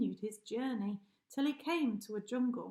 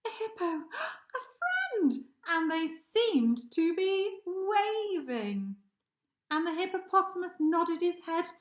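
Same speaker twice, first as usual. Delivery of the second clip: moved, laughing cry; 7 semitones higher, 6 dB louder than usual. A narrator's reading. Narrator overjoyed as the hippo finds a friend. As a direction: let the joy crack the voice.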